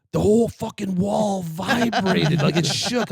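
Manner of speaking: high, slow, emphatic alarm; imitating freaked-out buddy. Spooked buddy describing the wall to prankster, imitated alarm. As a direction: stretch it out in mock panic.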